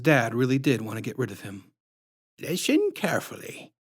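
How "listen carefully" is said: syrupy sinister clown woman introducing herself; creepy clown lady. A narrator's reading.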